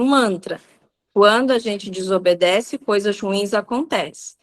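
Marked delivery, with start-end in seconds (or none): none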